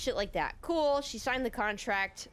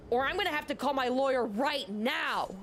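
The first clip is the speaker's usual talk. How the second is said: raised-pitch mock threat; imitating enraged customer; 6 semitones higher than usual. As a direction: act out an irate customer's threat. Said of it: "She's enraged".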